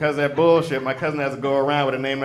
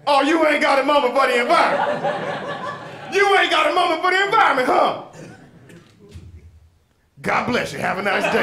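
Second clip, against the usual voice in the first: raised, mocking callout; sarcastic street hustler; 9 semitones higher than usual. Homeless man to a businessman who ignored him, mocking. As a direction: needle the passerby who walked on.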